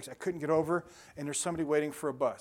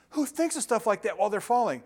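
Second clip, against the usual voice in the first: higher, louder incredulous question; mock incredulity; 6 semitones higher, 6 dB louder than usual. Relative reacting to the falling story, loud mock incredulity. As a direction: exclaim it in disbelief.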